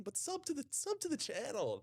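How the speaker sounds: squeaky high sing-song sub plea; hammed-up high channel plug; playful high plug. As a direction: pitch it way up like a cheeky ad. Plugging the channel.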